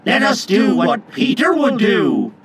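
high, loud chorus; rally everyone together in a shout; loud resolve in unison; boys' rallying cry. A narrator's reading. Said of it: "they cried".

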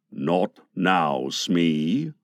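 very low, brooding pirate captain; dark Captain Hook. A narrator's reading. Hook puts off his eager boatswain, low and dark. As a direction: refuse darkly, deep in the voice. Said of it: "Hook said darkly".

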